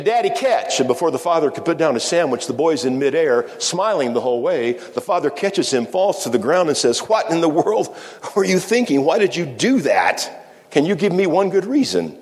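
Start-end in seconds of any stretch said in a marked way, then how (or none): 0.0-0.8 put-on much higher playful child's call; boy shouting to his dad from a rock; excited child. Boy calls from boulder. 7.0-12.2 much higher, incredulous questioning; father shaken after catching his leaping boy; astonished father. Retold or imitated. Shocked father after catching son.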